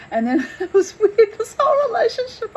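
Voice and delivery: giggling at how weird it was; amused laugh. Real, not acted. Laughing at odd dog bond.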